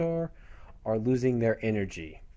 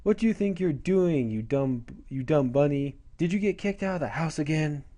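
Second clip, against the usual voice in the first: higher-pitched, scolding little-brother voice; taunting little brother; 5 semitones higher than usual. Retold or imitated. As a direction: needle your big sister like a bratty kid. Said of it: "the little brother harasses her, going".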